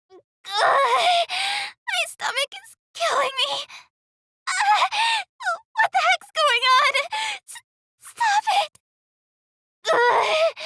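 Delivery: groaning, high-pitched agony; in pain. Acted. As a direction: cry out through sharp stomach cramps. Doubled up with stomach pain.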